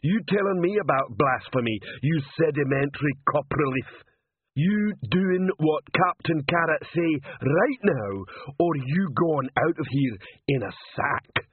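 threatening troll. Acted. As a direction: bear down on the culprit in troll voice. Troll threatens trembling potter.

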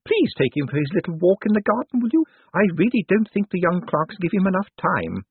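put-on imperious old lady, high-pitched; high voice of an elderly mistress addressing staff; Missus Lavish. A narrator's reading. Old lady instructs Bent.

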